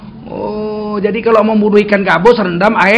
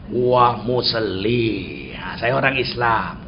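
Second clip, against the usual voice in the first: low, very slow, stretched words; drawn-out reply; 10 semitones lower, 7 dB quieter, much slower than usual. Retold or imitated. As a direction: stretch each word out low and long. Retold delighted reply to the chef.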